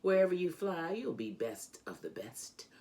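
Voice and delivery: usual speaking voice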